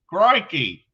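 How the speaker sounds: playful Australian exclamation; Australian accent. Pretend. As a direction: belt it out in a hammed-up Aussie accent. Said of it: "Oh, I can only say crikey".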